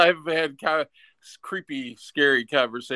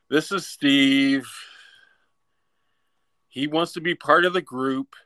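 mock introduction. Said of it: "Keith would be introducing me and be like".

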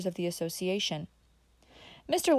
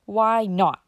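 louder, incredulous; staring disbelief; 9 dB louder than usual. Acted. Uncle Bobby staring at Dick, incredulous demand. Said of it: "still staring".

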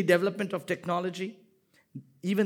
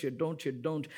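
ordinary talk, nothing marked

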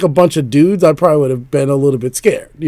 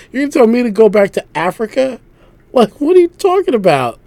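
raised pitch, mock-baffled protest; incredulous retort; 9 semitones higher, 4 dB louder than usual. Retelling his reaction to the lady on the T, incredulous. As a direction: throw it back in loud, high disbelief. Acted retort to being told go back.

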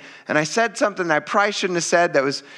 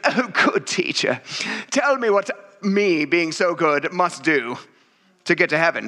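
mock rich young man. Rich young man quoted mockingly.

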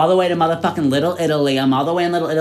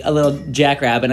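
ordinary talk, nothing marked

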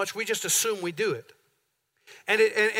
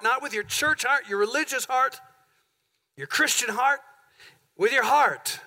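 preaching at full force; 4 semitones higher than usual. Acted.

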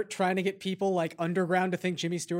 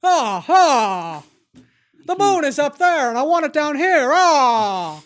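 a lightly voiced old-time leading man, higher and louder, drawn out; hammed-up old movie hero; 6 semitones higher, 8 dB louder, much slower than usual. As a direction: belt the moon line like a hammy old film star. Hams up a classic movie line.

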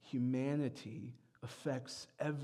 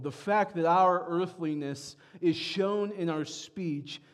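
raised, louder preaching push; 4 semitones higher, 6 dB louder than usual. Acted. Pastor pressing the church on how Americans think, preaching louder. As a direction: drive the point home to the congregation. Preacher builds toward Isaiah.

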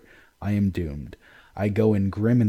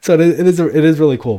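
much louder, higher burst of enthusiasm; excited; 8 semitones higher, 13 dB louder, faster than usual. Real, not acted. Reader gushing to a friend about the story, excited and loud. Excited reaction after reading.

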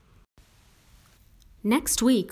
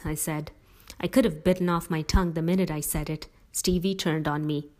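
ordinary delivery, nothing marked

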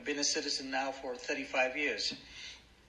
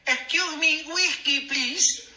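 high, loud, hammed-up bar order; rehearsed polite order; 10 semitones higher, 6 dB louder, slower than usual. Newcomer rehearses for an American bar, in a high, loud put-on voice. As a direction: overdo the polite customer, pitched way up. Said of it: "So I practiced in the mirror".

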